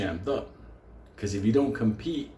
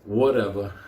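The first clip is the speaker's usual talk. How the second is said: usual speaking voice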